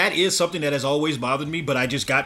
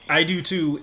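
ordinary talk, nothing marked

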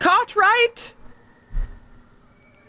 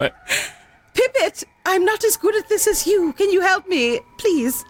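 high, pleading plea for help; 12 semitones higher, slower than usual. Real, not acted. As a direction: beg sweetly for help. Pleading with another player.

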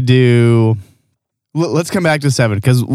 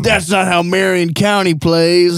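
mock listener; 7 semitones higher, slower than usual. Mocking listener correcting their rules.

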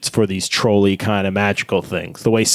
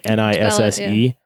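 usual speaking voice

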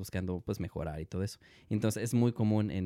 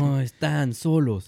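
reassuring slogan; 4 semitones higher, 7 dB louder, slower than usual. Acted. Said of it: "announces slogan to listeners".

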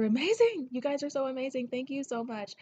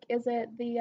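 usual speaking voice

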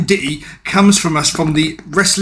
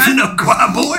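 higher mock Mister T voice, echoing; Mister T impression; 7 semitones higher than usual. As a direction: repeat the tough-guy line hammed up. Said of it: "best Mister T impression".

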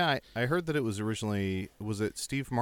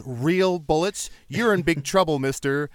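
slow, louder mock outrage; higher, slow indignant scolding; outraged Steve Martin quote; 4 semitones higher, 6 dB louder than usual. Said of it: "pulls out the bullet and goes".

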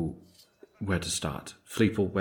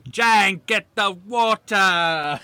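high, slow bossy yell; bossy high shout; 9 semitones higher, 4 dB louder, much slower than usual. Acted. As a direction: order the servant around. Character ordering servant again.

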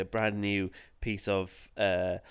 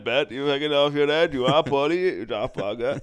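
put-on louder slurred Welsh drunk; drunk Cardiff guy; 4 semitones higher, 7 dB louder than usual. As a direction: play a rowdy Cardiff drunk. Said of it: "drunk guy in Cardiff".

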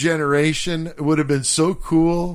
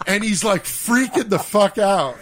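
ordinary delivery

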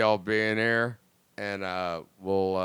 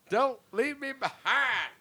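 high pleading voice; slower than usual. Pretend. Voicing a fart.

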